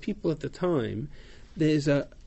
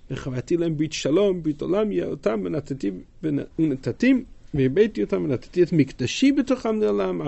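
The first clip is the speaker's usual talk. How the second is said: reading scripture aloud; 3 semitones higher, 4 dB louder than usual. Acted. Teacher reads Hebrew verse aloud.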